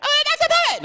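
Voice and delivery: put-on piercing high child squeal; little daughter begging. Daughter arguing with Daddy, shrieky high demand. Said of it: "my daughter".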